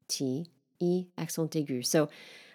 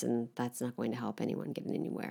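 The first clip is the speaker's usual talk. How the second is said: usual speaking voice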